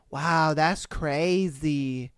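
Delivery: slow, low mock amazement; unbothered mimicry. Imitating a customer talking to a robbed cashier, blasé.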